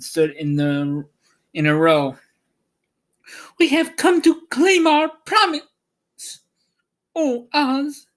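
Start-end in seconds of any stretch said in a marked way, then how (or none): 3.6-8.1 put-on much higher little-girl voice; a brave child claiming her due; Dorothy pleading. A narrator's reading. Dorothy reminding the wizard of his promise, high and childlike. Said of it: "Dorothy said".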